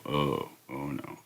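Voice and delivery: very low, hushed groan of dismay; mock dread. Parent reading the school's covid letter, hushed mock dismay. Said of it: "And then you go".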